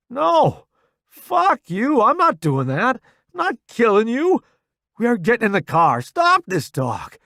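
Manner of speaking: high, loud angry refusal; furious shouting. A narrator's reading. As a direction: shout him down in fury. Furious refusal to kill friend.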